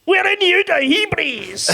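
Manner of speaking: put-on high, loud Scottish accent. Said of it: "Say that word again".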